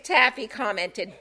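ordinary delivery

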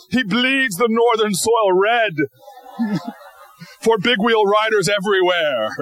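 mock-epic narrator voice; mock announcer voice. TV show announcer mockingly introduces the little boy. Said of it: "The splendiferous voice announcer goes".

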